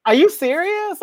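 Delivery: high, loud disbelieving question; incredulous. Retold or imitated. Looking at admirers, high loud mock disbelief. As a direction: ask it like you can't believe them. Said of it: "I be looking at people like".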